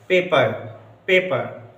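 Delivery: पेपर as pay-puh, dropping the r; no final r. Shown as a demonstration. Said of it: "क्या पढ़ा जाएगा?".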